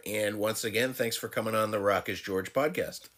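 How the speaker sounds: normal talking voice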